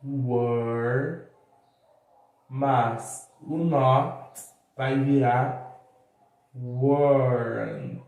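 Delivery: slow, drawn-out demo; slow teaching delivery. Shown as a demonstration. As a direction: slowly build the contraction. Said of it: "A mesma coisa para o were". (explicação lenta, palavra por palavra)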